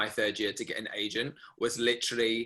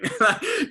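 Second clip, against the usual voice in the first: short laugh; 6 dB louder than usual. Real, not acted. Laugh after realisation.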